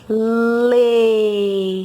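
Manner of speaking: "Lê" held long, loud and open-mouthed; exaggerated syllable. Shown as a demonstration. Teacher showing the child how her mouth makes it, exaggerated. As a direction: stretch "Lê" for the child to copy. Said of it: "Olha aqui a boca da tia".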